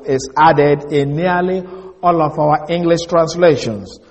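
normal talking voice

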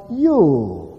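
a long, emphatic "you"; stress on you. Shown as a demonstration. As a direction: land hard on "you" and stretch it. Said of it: "puts emphasis on the word".

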